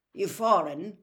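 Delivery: loud, blunt challenge; blunt question. A narrator's reading. Manager questions a visitor fumbling with money, bluntly. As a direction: put it to him bluntly.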